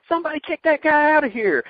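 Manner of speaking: high, loud exasperated impression; Cornette impression. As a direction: squawk it like a cranky manager. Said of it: "he goes".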